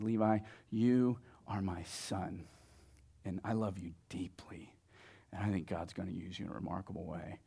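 low, very soft, heartfelt; tender blessing. Retold or imitated. Dad blesses his nine-year-old son, tenderly. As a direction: say it gently, hushing toward a whisper.

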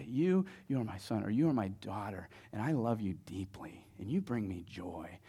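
low, soft, sincere; tender affirmation. Retold or imitated. Dad looks his child in the eye, tenderly.

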